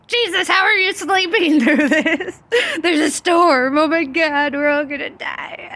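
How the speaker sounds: high, loud, laughing mock panic; hammed-up terror as the boat rocks. Disciples waking Jesus in the storm, hammed-up panic.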